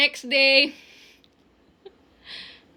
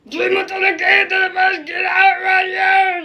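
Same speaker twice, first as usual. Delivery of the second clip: angry principal; 3 semitones higher, 3 dB louder than usual. Acted. Principal shouting again.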